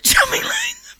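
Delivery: real helpless corpsing; breaking into laughter. Real, not acted. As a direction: voice squeaking high with laughter. Presenter corpsing during traffic report.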